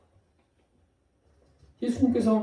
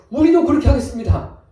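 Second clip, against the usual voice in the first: high, loud eager pledge; all shout the promise together; eager loud chorus; 7 semitones higher, 8 dB louder than usual. Acted. Disciples chime in eagerly. (높고 크게 외치는 다짐)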